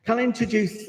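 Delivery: usual speaking voice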